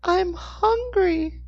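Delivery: put-on very high, slow child voice; strained little boy. A narrator's reading. Hungry boy to a stranger, strained little boy. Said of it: "said in a strained voice".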